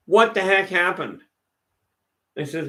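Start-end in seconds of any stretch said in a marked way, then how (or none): 0.1-1.2 higher, louder stunned cop shouting the question; shocked cop outcry. Retold or imitated. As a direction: cop staring at a torn-off arm, shocked and louder. Cop shocked at torn arm.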